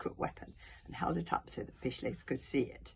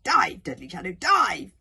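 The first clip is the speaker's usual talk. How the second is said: loud, urgent command; ordering; 3 semitones higher, 12 dB louder than usual. A narrator's reading. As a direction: urge the mount on forcefully. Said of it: "ordered Kamikaze".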